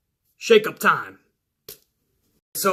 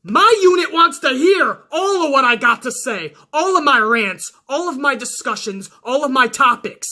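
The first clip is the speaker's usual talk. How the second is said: pumped-up rant at a troll; heated rant; 6 semitones higher than usual. Real, not acted. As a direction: defend your channel with heat. Ranting at commenter.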